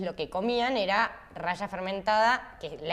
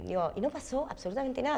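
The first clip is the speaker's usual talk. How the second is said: ordinary delivery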